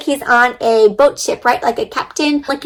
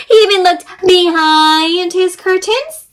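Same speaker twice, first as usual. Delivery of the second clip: dramatic storytelling; 6 semitones higher, slower than usual. A narrator's reading. Dramatic search narration.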